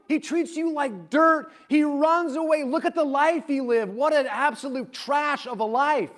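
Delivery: put-on an older son venting years of grievance; acted angry older brother; resentful outburst.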